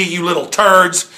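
ordinary talk

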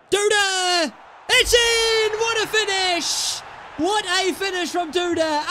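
goal celebration. Real, not acted. Gamer celebrating his own goal, high excited yell. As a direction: scream it like you just scored.

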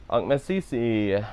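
Messi said slowly, first I stressed then dropped; slow careful Ang Messi. Shown as a demonstration. Learner copying the teacher, slow and careful. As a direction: stretch out Ang Messi syllable by syllable. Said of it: "first bit of the I has some emphasis and then you drop it".

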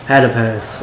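ordinary talk, nothing marked

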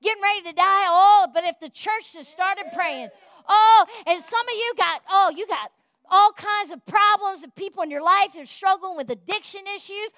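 loud, fired-up preaching. Real, not acted. Pastor urging the church to pray, fired-up preaching. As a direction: preach it with full fire to the church.

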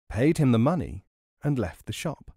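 ordinary delivery

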